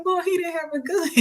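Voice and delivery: high-pitched amused protest; laughing retort. Retold or imitated. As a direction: re-enact brushing off danger through laughter. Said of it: "I'm in there laughing".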